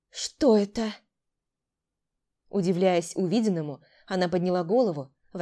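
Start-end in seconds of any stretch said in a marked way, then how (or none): none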